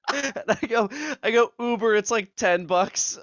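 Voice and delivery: high and loud, fighting giggles; amused retelling. Real, not acted. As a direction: keep telling it while cracking up. Cracking up while retelling text exchange.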